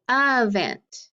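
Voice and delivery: "event" drawn out as uh-VENT; schwa. Shown as a demonstration. Teacher showing students the coded word, schwa "event". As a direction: open "event" on uh, like a short U. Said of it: "It makes that schwa sound".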